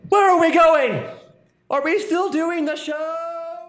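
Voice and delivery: high, panicky protest; panicked. Acted. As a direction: squeal like you're being dragged away. Dragged away panicking.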